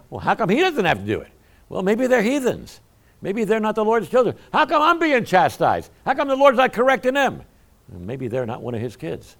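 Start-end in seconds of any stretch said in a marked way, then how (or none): 0.1-7.4 higher, rising indignant grumble; whining complaint. Retold or imitated. Pastor voicing the congregation's complaint, higher indignant whine. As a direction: whine like a wronged child of God. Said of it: "we say".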